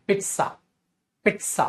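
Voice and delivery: पिज्जा said as "pit-sa", with a clear ts sound; actual pronunciation. Shown as a demonstration. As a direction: say पिज्जा as "pitsa", not "piza". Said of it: "असली actual pronunciation क्या होती है". (पिज्जा को "पित्सा" बोलो, ts की आवाज़ के साथ)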